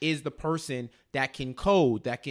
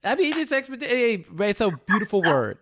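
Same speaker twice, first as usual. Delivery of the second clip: delighted; 6 semitones higher, 4 dB louder than usual. Real, not acted. Relishing the word expeditiously.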